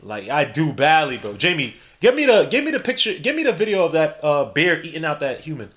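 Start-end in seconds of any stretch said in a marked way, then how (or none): 1.3-5.7 raised, urgent podcast-host request; Joe Rogan. Acted. Host asking Jamie for a video, excited mock demand. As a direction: keep calling on your producer for the clip. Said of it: "suit to Joe Rogan, but they love doing that".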